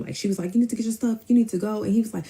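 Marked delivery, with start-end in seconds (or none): 0.5-1.8 retold yelling. Cousin yelling at him to go, mock yell. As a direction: imitate her ordering him out, pitched up. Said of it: "she yelling at him".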